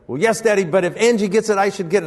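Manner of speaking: put-on high whiny little girl; child's protest. Daughter argues with father, high whiny child voice. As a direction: insist like a stubborn child. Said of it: "And she said".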